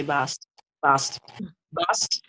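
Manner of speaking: "Bast" softly repeated with an open ah; ah pronunciation. Shown as a demonstration. Teacher modelling the goddess's name, softly with an ah sound. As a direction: quietly sound out "Bast" as "bahst". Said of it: "the ah sound is very significant".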